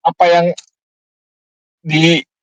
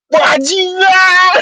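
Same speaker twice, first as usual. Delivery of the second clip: long, high-pitched cry; retold yelled curse; 13 semitones higher, much slower than usual. Leaving the classroom between rooms, yelled curse. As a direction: belt out the curse, stretching it high. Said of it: "Lagi ku ngomong".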